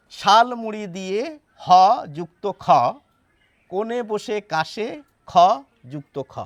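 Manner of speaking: ক্ষ read as "যুক্ত খ", each line ending on যুক্ত খ; rhyme with যুক্ত খ. Shown as a demonstration. Teacher tests a second reading, ending on যুক্ত খ. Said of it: "এবার এভাবে পড়ে দেখি".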